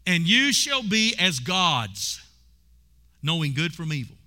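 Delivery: drawn out, every word weighed; slow scripture reading. Acted. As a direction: read the promise slowly and heavily.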